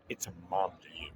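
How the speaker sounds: low, soft mock mom correction; deadpan mom putting him in place. Mom in the ad looking down at her son. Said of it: "she looks down at him and she goes".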